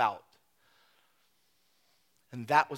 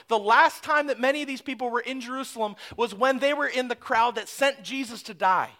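usual speaking voice

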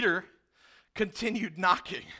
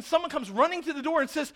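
ordinary delivery

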